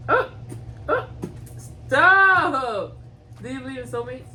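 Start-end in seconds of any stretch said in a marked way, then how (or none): 0.1-3.0 loud sharp yell; shouting stop. Real, not acted. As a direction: yell it out, playful but loud. Couple teasing, she shouts.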